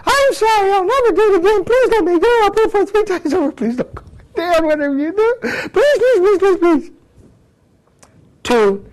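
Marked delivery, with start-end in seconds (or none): 0.1-6.9 much higher, whiny pleading woman voice; begging woman. Retold or imitated. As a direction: beg like a panicked shoplifter, please. Woman begging officer to release her.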